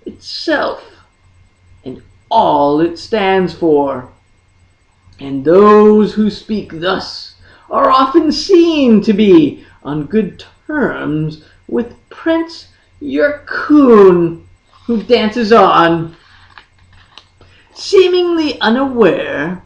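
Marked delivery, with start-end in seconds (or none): none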